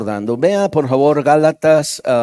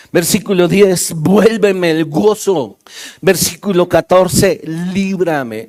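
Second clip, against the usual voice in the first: loud, ringing pulpit delivery; preacher calling out psalm verses loudly; full-force preaching; 4 semitones higher, 5 dB louder, slower than usual. Acted. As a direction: hammer each verse home to the congregation.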